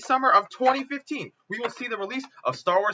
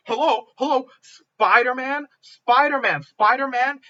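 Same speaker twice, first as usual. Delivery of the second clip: higher, loud, as if stating the obvious; emphatic insistence; 5 semitones higher, 5 dB louder than usual. Real, not acted. Fan arguing for a top-tier hero, insistent. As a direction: hammer the name home with each repeat.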